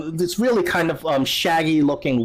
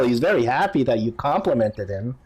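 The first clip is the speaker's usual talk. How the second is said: ordinary talk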